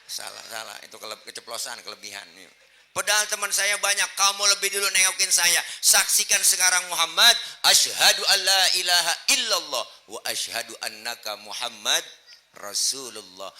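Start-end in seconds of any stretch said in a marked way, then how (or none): 2.9-13.5 high, loud declaiming; impassioned preaching. Acted. Preacher voicing a sick man's confession to the Prophet, impassioned preaching. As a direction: preach it out at full force. Voices convert's testimony at full force.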